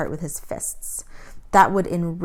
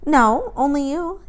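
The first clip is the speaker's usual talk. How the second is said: defiant; 9 semitones higher, 5 dB louder than usual. A narrator's reading. Girl answers soldier defiantly.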